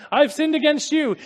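high, loud, urgent confession; apologizing son. Acted. Son trying to apologize to his excited father, urgent. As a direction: blurt out an acted apology. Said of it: "you can imagine the son saying it".

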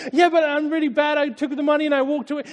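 high, loud, urgent confession; apologizing son. Acted. Son trying to apologize to his excited father, urgent. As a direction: blurt out an acted apology. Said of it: "you can imagine the son saying it".

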